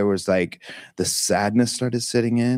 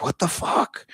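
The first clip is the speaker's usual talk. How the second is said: whispered panic; 8 semitones higher, 4 dB quieter than usual. Retold or imitated. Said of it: "and I'm like".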